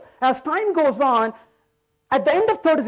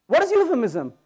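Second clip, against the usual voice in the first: ordinary talk, nothing marked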